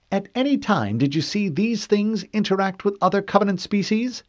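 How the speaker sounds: high, precise questioning woman; cool female interrogator. Acted. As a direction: question him coolly.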